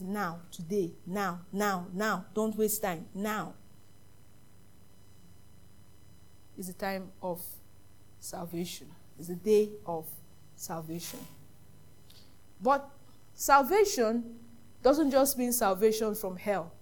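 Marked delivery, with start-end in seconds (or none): 0.0-11.2 low, soft preaching; hushed solemn appeal. Real, not acted. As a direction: urge them quietly, low and grave. Solemn urging from the pulpit.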